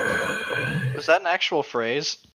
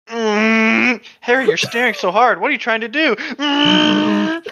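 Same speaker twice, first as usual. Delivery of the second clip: straining grunt; 8 semitones higher, 7 dB louder, slower than usual. Acted. Straining hard to stare, powering up.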